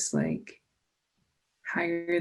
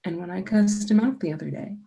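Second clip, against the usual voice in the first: usual speaking voice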